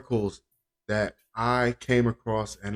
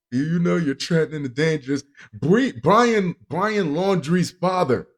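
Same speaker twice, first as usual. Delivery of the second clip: normal talking voice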